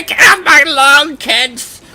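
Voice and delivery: put-on high-pitched cranky old-timer voice; old get-off-my-lawn guy. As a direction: complain like an old man yelling at kids. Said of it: "like an old get off my fucking lawn guy".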